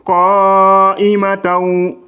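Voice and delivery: long drawn chant, qa'imataw ending in a waw glide; Quranic recitation. Shown as a demonstration. Teacher chanting the word for the class. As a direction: stretch qa'imataw out slowly, melodically. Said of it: "Regardez ici".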